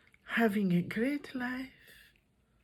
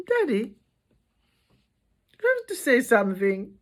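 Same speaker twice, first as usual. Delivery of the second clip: pleading with dad; 2 semitones higher, 6 dB louder, faster than usual. Acted. Appeals to father in story.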